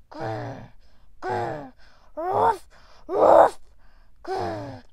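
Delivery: growl, then loud, high barking; dog growl and bark. Acted. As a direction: do the angry chained dog. Imitating the neighbor's dog.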